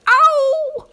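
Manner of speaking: high, loud yelp; cry of pain. A narrator's reading. Nicobobanus grabbed by the neck, yelping loud. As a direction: yelp as fingers grab you.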